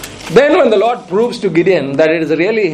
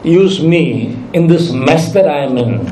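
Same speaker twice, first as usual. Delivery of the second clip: slow doubting thought; much slower than usual. Acted. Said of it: "you're thinking".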